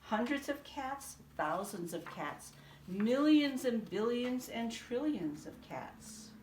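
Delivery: plain reading voice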